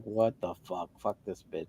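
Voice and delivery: low, soft cursing under the breath; retold disgust. Man seeing the boyfriend pull up, quietly fed up. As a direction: curse to yourself, dropped low and quiet. Retells his private anger at her.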